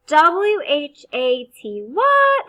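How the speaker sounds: letters spelled slowly, then the word popped up high; spelling out letters. Shown as a demonstration. Teacher spelling a sight word for first graders. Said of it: "This is what we do".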